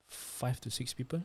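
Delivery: ordinary talk, nothing marked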